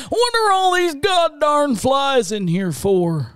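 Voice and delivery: put-on old man. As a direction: grumble like an old codger. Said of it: "as an old man".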